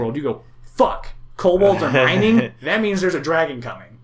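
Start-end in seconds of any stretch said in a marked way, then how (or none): 0.6-2.5 loud, high burst of panic; alarmed. Acted. Adventurer realizing what mining kobolds mean, alarmed shout. 2.6-4.0 loud, high urgent warning; alarmed. Acted. Adventurer warning of a coming dragon, alarmed.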